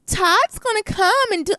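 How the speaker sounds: high, slow mocking of the roommate; imitating how she said Todd's name; mocking her voice. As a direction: drag it out in her voice, high and sing-song. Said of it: "I hate the way she said his name".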